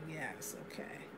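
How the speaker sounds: breathy whispered yes, okay; soft surrendering whisper to my hair. Pretend. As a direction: whisper it back with a falling tune. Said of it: "And I'll be like".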